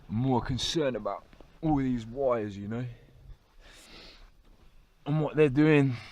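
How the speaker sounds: ordinary delivery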